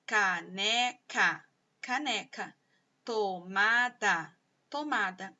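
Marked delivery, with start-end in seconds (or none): 0.1-1.4 "Caneca" slowly split into three drawn-out syllables. Shown as a demonstration. Teacher showing pupils the sounds in a word, "Caneca" syllable by syllable. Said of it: "sílaba por sílaba". 3.0-4.3 "Tomada" stretched into three separate syllables. Shown as a demonstration. Teacher showing pupils the word's sounds, "Tomada" syllable by syllable. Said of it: "sílaba por sílaba".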